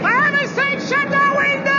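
high, loud shout. Acted. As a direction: yell it over the noise. Shouting over train noise.

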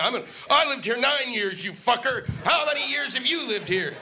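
high, heated road-rage rant; angry driver yelling. Pretend. Stuck driver screaming at another driver in traffic jam, angry. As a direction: yell it like a driver at his seat-mate.

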